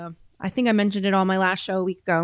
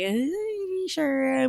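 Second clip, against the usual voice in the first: doubtful; 6 semitones higher, 6 dB quieter, much slower than usual. Retold or imitated. Doubting her dad, quoted.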